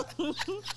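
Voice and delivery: soft, high giggle; amused laugh. Real, not acted. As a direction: laugh lightly at yourself. Laughing at own singing.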